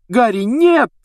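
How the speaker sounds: high, loud urgent cry; alarmed shout. Acted. Hermione stopping Harry from running out, alarmed shout. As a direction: call out sharply to stop him.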